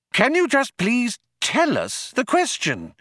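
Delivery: high-pitched, impatient begging; exasperated plea. A narrator's reading. Loonquall demands the question from computer, exasperated. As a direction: plead at the end of your patience.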